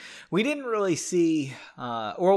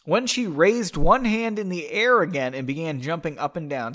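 normal talking voice

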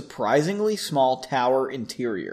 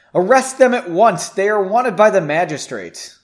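loud, raised-pitch order; demanding; 4 semitones higher, 7 dB louder than usual. A narrator's reading. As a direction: demand obedience from the soldiers. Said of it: "he demanded".

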